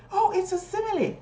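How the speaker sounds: high, animated classroom exchange; teacher playing pupils. Shown as a demonstration. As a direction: voice both pupil and praising teacher. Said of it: "playing the class answering".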